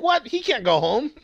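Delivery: hammed-up surprised announcer; incredulous announcer. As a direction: imitate a narrator's mock disbelief. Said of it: "even the announcer's like".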